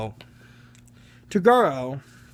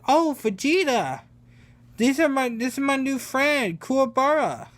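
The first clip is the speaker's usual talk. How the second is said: bright, much higher excited greeting; cheerful Goku; 11 semitones higher than usual. Retold or imitated. Goku introducing Kuwabara to Vegeta, excited and high. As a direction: introduce your new buddy with glee.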